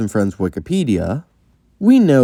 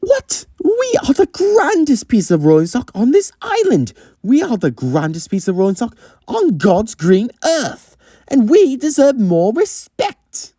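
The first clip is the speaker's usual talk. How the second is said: very high, indignant pompous outburst; hammed-up outrage of a grand lady; Octavia; 13 semitones higher than usual. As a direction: protest loftily, high and affronted. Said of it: "Octavia is more indignant".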